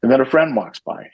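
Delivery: normal talking voice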